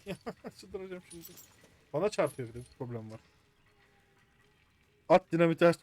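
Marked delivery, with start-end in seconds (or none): 0.1-1.3 laughing exasperation. Real, not acted. Frustrated with the puzzle. 5.1-5.8 much louder, urgent call to the screen; urgent shout. Real, not acted. Urgent shout during game.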